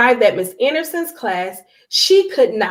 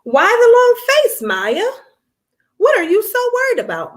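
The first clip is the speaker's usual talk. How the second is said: high, gentle mother's question; lightly voiced caring mommy; concerned mom voice; 10 semitones higher, 3 dB louder than usual. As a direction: coax a sad child to talk. Mother asks worried daughter.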